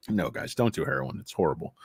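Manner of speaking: ordinary delivery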